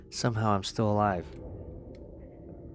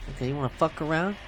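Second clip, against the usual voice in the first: taunting; 6 semitones higher, faster than usual. Real, not acted. Fighting the butterfly, taunting it.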